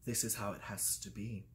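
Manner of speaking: very low, very soft imitation; hushed solemn pronouncement. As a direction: murmur it like a grave mentor. Acting out grave Dumbledore figure.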